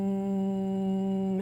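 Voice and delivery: low steady closed-lip hum; hummed M. Shown as a demonstration. Coach humming along with the partners, steady hum. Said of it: "lips shut, just making the M sound".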